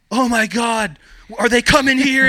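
high, loud frightened shriek, rising to a yell; panicked scream. Retold or imitated. Wife fearing a police raid, imitated panicked scream.

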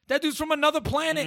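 high-pitched insistent protest; high, exasperated rebuttal; heated objection. Real, not acted. Heated argument over a character's race.

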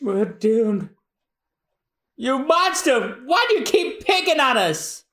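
high, anguished character cry; despairing wail. Acted. Crewman confronting the monster who attacks them, despairing. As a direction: wail high at your tormentor. Crew wails at their attacker.

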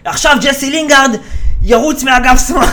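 high, loud mock declaration; hammed-up commentator shout; imitated pundit call. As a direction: announce it with over-excited false authority. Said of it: "ואז הוא אומר".

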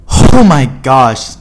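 drawn-out loud "oh my gosh"; loud exclamation. Real, not acted. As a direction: blurt it out loud and slow. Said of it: "I just probably blew out the mic".

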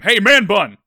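big cartoony call-out; dub coach calling out. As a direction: holler the nickname like an anime coach. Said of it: "in the dub, he calls him".

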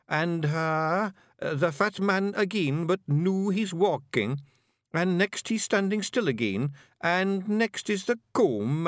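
broad Scots pictsie puzzling out letters; Rob Anybody reading. Acted. Rob decoding chalked letters for the Feagles, earnestly. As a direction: sound out each letter like a proud beginner.

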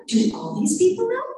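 high, incredulous question; scandalized astonishment. Retold or imitated. Patient looking around at everyone, scandalized. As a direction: ask as if the secret is out, pitch climbing.

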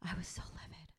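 whispered, no voice. Real, not acted. Telling her friend how angry she was, whispered. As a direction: breathe it out as a whisper. Repeated, first take whispered.

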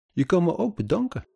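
high, aggrieved complaint. A narrator's reading. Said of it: "Verongelijkte stem". (verongelijkte, hoge stem)

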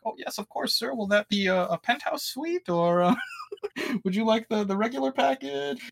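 high, sugary mock greeting; fake politeness. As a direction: put on an innocent host voice. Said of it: "they're just like".

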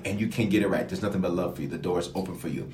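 soft, gentle invitation; hushed and welcoming; tender reassurance. Real, not acted.

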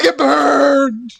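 high, loud hammed-up yell; overacted Cage bark; mock shout. Host finishes the quote, yelling it like the actor. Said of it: "I'm great at quoting Nicolas Cage".

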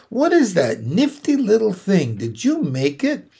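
put-on a wide-eyed young pig asking; curious young pig. A narrator's reading. Wilbur asking Charlotte about the sack, curious.

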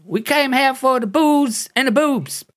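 squeaky fake Brit tourist, cheeky; bad British accent. Fake Brit explaining why they came to Canada, high Cockney accent. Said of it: "a terrible British accent".

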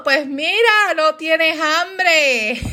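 high, drawn-out comic telling-off; hammed-up rebuke of a picky eater; mock scolding. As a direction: stretch the last words out, laughing at the end.

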